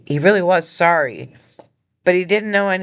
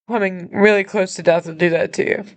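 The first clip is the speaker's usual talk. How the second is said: steady yourself through the tears; emotional; 5 semitones higher than usual. Real, not acted. Explaining to viewers why she is crying, tearful. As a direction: still tearful, pitched higher. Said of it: "Sorry, I'm emotional".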